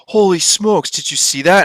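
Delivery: high, loud burst of amazement; excited exclaim. Retold or imitated. Driver shouting to friend about a streaking light, high and loud. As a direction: blurt it out, stunned.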